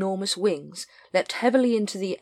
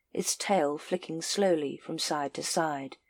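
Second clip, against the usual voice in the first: usual speaking voice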